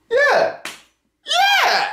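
high excited squeals; excited yeah. Real, not acted. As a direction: cheer it, high and bright.

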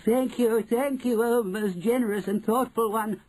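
high, gushing witch voices; chorusing ancient witches. Acted. Witches thank their leader, gushing high chorus. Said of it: "chorused the ancient witches".

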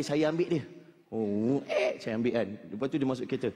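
normal talking voice

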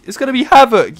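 high, loud hype; excited. Real, not acted. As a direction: shout it out excitedly. Hyped about next event.

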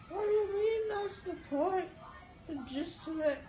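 high, loud upset cry; upset shout. Retold or imitated. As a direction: wail it out indignantly. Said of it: "then she's like".

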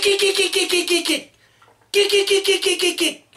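high choppy squeaky kick bursts; rapid high-pitched duck imitation; teal hen call. Shown as a demonstration. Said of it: "it's almost like a laugh".

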